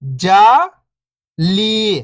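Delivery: first syllable lifting, pause, second falling. Shown as a demonstration. Teacher breaking a word down for beginning readers, syllable by syllable. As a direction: sound out each syllable alone, long and separated. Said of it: "एक अक्षर का उच्चारण करके".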